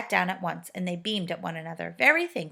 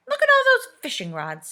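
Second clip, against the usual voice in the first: Silky's voice; 8 dB louder than usual. A narrator's reading. Silky points out fishing rods.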